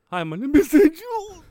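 hammed-up tearful break, high and loud; mock crying. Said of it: "I'll be like".

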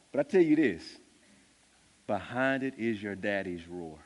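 normal talking voice

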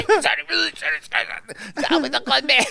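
high, muffled boxer impression; Mike Tyson. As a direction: keep gushing in a high, smothered voice. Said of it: "You hear muffled. You hear, just hear".

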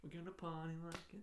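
voiceless, half-sung, fast lyric; whispered song. Retold or imitated. Suggesting sidekicks' reply, a fast whispered lyric. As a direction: mutter the tune, embarrassed. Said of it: "I don't wanna fucking sing".

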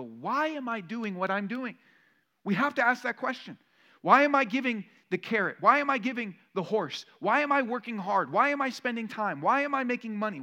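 building challenge. Real, not acted. Preacher's rising questions.

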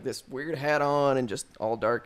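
normal talking voice

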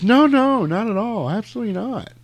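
high, hammed-up innocent protest; mock innocent denial. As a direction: play the cop backpedalling in a high voice. Cop's high denial, retold.

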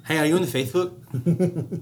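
Faithbook said slowly and high with a lisp; mock lisp on Faithbook. Shown as a demonstration. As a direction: draw out "Faithbook?" like a lisping kid. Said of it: "making fun of kids with lisps".

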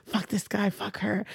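quiet, retold inner curse; hushed grudge, kept inside; seething under breath. Retold silent seething while staring.

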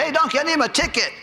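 mock rude customer shouting for service; rude local. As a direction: boss the waiter around, high and rough. Locals barking orders at waiter.

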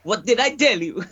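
put-on high cod-Italian accent, half laughing; hammed-up Italian mimicry, breaking into laughter; mock Italian. Podcaster echoing the clip, laughing mock Italian voice. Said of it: "It's like an Italian vo".

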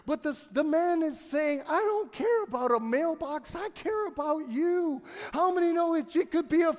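high, loud fervent preaching; preaching at full force. Acted. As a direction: build the crowd up with fire.

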